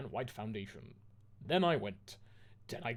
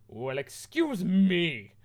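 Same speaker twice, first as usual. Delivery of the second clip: slow, louder, higher mock-indignant yell; shouted angrily; 6 semitones higher, 9 dB louder, much slower than usual. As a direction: drag it out huffily. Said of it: "they both shouted angrily".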